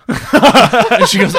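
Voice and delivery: laughing lead-in. Real, not acted. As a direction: laugh before quoting her. Laughing into the quote.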